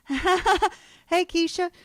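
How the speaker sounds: a high, loud mock call of Keisha; retold exclamation. Retelling her shock at the ravens, high mock call. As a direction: pitch it up and call out. Retold shout while driving.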